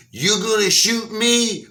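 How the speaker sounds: high, slow mocking dare; taunting. Wife taunting husband with the gun, high mocking dare. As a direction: tease him with a high, drawn-out dare. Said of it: "She was, like, taunting him".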